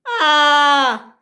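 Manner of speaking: long high, loud "ah"; drawn-out high cry; acted scream. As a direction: wail while falling. Engine screaming while flying through air.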